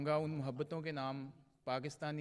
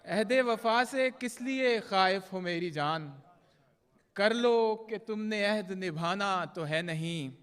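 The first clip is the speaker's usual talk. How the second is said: measured, projected mushaira verse with drawn-out line endings; poetry recitation; 6 semitones higher, 10 dB louder, slower than usual. Acted. Poet reciting love couplets to a mushaira audience, declaimed Urdu verse. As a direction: recite the ghazal line by line, pausing between phrases.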